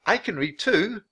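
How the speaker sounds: put-on high, hopeful little-girl pitch; high-pitched, angling offer; voice going up. A narrator's reading. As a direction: lift your voice high to volunteer. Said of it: "Her voice went up".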